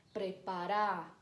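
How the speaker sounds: "preparar" said slowly, stressed on the final syllable; stress on last syllable. Shown as a demonstration. Teacher showing the tonic last syllable, slow final stress. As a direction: draw it out and land on the end of "preparar". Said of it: "a última sílaba é a tônica".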